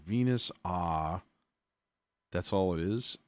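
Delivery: ordinary talk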